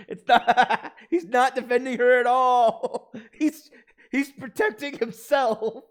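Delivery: high, loud words through laughter; helpless laughing, voice shooting up; real laughing fit. Real, not acted. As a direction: crack up and squeal it out, slowly. Player cracks up at the scene.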